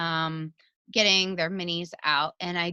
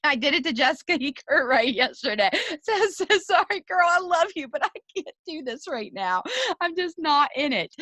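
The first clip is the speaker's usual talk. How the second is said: high-pitched, giggly retelling; talking through real laughter; laughing through words; 9 semitones higher than usual. Real, not acted. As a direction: laugh your way through the apology. Laughing as she tells what she said.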